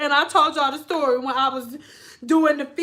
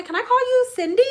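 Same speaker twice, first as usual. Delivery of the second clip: put-on chirpy old lady; 8 semitones higher, faster than usual. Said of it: "sometimes they would say".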